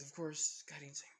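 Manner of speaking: usual speaking voice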